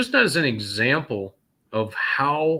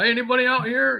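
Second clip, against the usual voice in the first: higher pitched mock call into an empty room; retold call out; 12 semitones higher, faster than usual. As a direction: call out to whoever might be there. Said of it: "I'm just yelling out".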